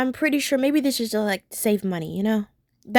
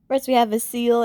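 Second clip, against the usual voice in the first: ordinary talk, nothing marked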